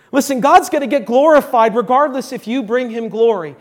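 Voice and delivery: normal talking voice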